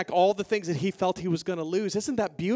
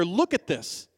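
ordinary talk

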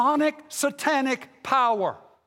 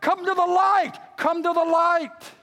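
ringing pulpit call, pitched way up; hollered appeal; 9 semitones higher, 6 dB louder than usual. Acted. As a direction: holler it out to grab hearts. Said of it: "I'm just trying to holler out there".

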